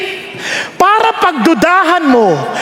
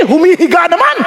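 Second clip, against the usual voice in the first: ordinary delivery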